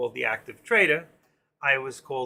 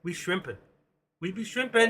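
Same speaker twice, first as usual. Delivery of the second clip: lightly imitating the Louisiana guest's accent; 5 semitones higher than usual. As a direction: playfully put on a Louisiana shrimper's accent. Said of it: "I love that accent".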